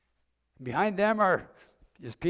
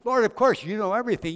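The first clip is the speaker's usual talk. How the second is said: much louder and higher, an exasperated outburst; upset and angry; 9 semitones higher, 9 dB louder, faster than usual. Acted. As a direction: burst out, hurt that he's asked again. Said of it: "Peter becomes rather upset and angry".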